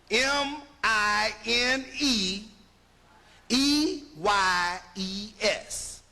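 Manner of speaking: M I N E E Y E S spelled letter by letter, slow and low; spelled out slowly. Shown as a demonstration. Spelling the words out for the class. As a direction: spell each letter out deliberately. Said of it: "Give you another example".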